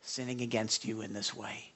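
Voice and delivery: soft, humble request for forgiveness; quiet sincere plea. Acted. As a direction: ask earnestly and gently. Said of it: "No, you ask forgiveness".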